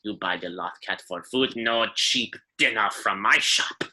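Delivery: put-on cranky old alien lady in broken Basic; old shopkeeper voice, grumbling; old Rhodian shopkeeper. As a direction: threaten then warm up, in character. Shopkeeper character voice.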